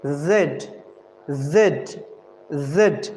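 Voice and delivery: Z said low and deliberate, repeated; Z said clearly with a low falling tone; letter demo. Shown as a demonstration. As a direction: drill Z for the class. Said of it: "what you can pronounce it?".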